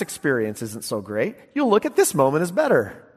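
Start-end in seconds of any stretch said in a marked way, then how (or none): none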